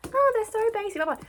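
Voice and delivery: very high put-on sneering quote; mocking the critics. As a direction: mimic snobby critics in a high voice. Mimics dismissive critics of the books.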